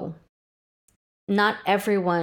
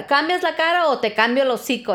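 mock scolding, higher and quick; imitated maternal threat; 5 semitones higher, faster than usual. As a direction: rattle off a retold parent's threat. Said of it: "You hear it".

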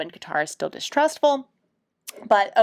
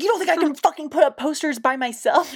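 higher, fast, offended retort; indignant; 6 semitones higher, faster than usual. Retold or imitated. Toph snapping at Sokka, higher and fast. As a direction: fire it back, insulted. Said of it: "She's so indignant".

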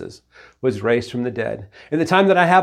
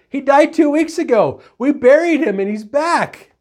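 high, loud, hammed-up panic; hysterical cry; 12 semitones higher, 5 dB louder than usual. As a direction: cry out as the freaked-out neighbor. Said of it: "her neighbor cried".

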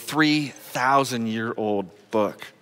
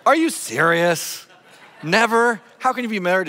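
high, louder mock astonishment; playful disbelief; 8 semitones higher, 4 dB louder than usual. Real, not acted. Pastor teasing a congregant who never saw Star Wars, mock disbelief. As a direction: act amazed, voice jumping up on never.

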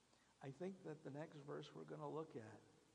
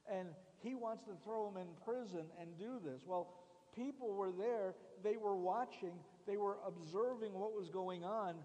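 louder, pressing the outrage; fiery preaching; 4 semitones higher, 6 dB louder than usual. Acted. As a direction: preach it hard to the crowd. Preaching at full force.

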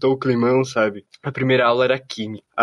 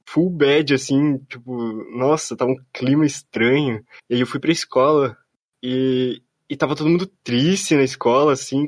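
ordinary talk

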